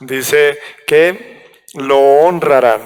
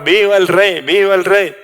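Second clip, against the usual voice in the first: mild mock crowd chant, pitched up; higher-pitched pretend acclamation; mock cheer; 4 semitones higher than usual. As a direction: imitate a crowd hailing a king, without really shouting. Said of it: "comencemos a gritar".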